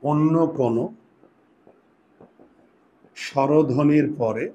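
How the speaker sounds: slow, spaced dictation for writing down; slow dictation pace. Shown as a demonstration. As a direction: dictate each part slowly so they can write. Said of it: "লিখো।".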